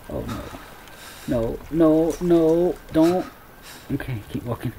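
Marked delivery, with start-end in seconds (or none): none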